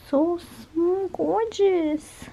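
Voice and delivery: high cooing praise. Real, not acted. Owner cooing over her dog's paw, high voice.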